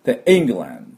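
England with the first syllable hit hard; England, weight on the opening E and a light land; stress on the E. Shown as a demonstration. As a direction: punch the E of England. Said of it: "最初にアクセントがありますから".

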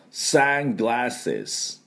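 slow "サングラス" as English "sunglasses"; English pronunciation. Shown as a demonstration. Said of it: "どんな発音なのかというと".

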